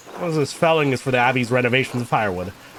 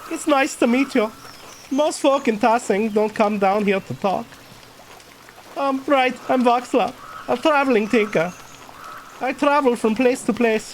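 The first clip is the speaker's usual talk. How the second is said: put-on higher-pitched German-accented character; 10 semitones higher than usual. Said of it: "went for the German accent with this guy".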